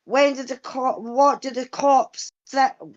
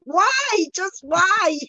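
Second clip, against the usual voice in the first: high incredulous "why", much higher; mock disbelief; 7 semitones higher, slower than usual. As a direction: plead in comic disbelief, pitched well up. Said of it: "and it's like".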